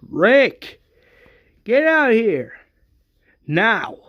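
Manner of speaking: loud, high-pitched yell across the gate; screaming. Acted. Governor screams for Rick to come outside, screaming. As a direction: shout it out, high and drawn out. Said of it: "The governor screams".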